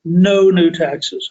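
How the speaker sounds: each word slowly spaced, No new taxes; a mocking politician quoting Bush, spelling out the slogan slowly; slow emphatic slogan. A political commentator mimics Bush's campaign line, slow and deliberate. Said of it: "he goes on to say".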